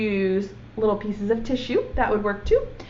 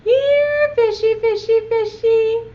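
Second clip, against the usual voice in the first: much higher sing-song coaxing call; high lilting fishy call; luring call; 12 semitones higher, 6 dB louder than usual. Acted. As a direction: coax the fish out sweetly, loud. Boy lures whale with fishing pole.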